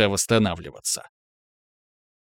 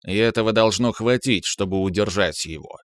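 ordinary delivery, nothing marked

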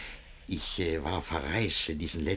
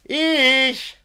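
high, loud single word; loud call; 8 dB louder than usual. Acted. Master calling through the door, loud.

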